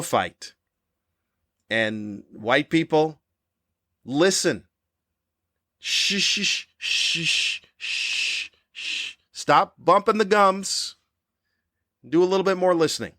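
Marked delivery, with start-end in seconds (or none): 5.8-9.2 long unvoiced shush, fading out. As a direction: hush the room with a fading shh.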